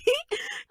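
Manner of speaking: high giggle. Acted. Giggle after tearful outburst.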